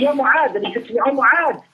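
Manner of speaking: voicing the Prophet calling Mu'adh. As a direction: call his name out with emphasis.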